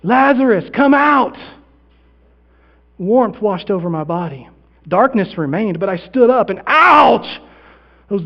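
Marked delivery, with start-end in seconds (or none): 0.0-1.4 high, raised summoning voice; loud, higher-pitched summons; commanding call. Acted. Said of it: "I hear something, it sounds like". 6.7-7.4 loud, high, drawn-out ouch; sudden exclamation of pain; pained yelp. Acted. Acting sudden pain on standing.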